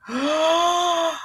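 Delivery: sharp gasp, voice jumping high; startled gasp. Real, not acted. Host reacting as her coffee cup falls, startled gasp. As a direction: caught off guard mid-word.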